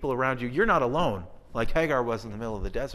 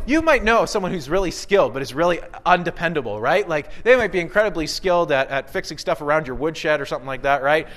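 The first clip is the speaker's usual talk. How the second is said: normal talking voice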